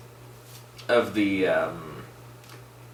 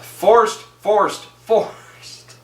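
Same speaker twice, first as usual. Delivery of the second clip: high, loud, fed-up repetition; exasperated; 11 semitones higher, 5 dB louder, much slower than usual. Retold or imitated. Reviewer mocking a forced joke, high exasperated chant. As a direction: keep hammering the word, higher and exasperated.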